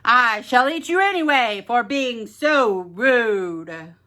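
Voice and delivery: a big monster king menacing the small chef; monster king threat. Acted. Monster king to the chef, a gruff menacing threat.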